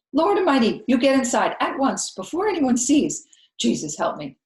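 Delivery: loud, urgent scolding housekeeper; alarmed scolding. A narrator's reading. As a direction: hurry the child inside, horrified. Viola upset, charging at girl.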